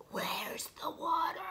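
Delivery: tiny, raspy whisper; small raspy voice. Acted. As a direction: rasp it out small and weak. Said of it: "small, raspy voice".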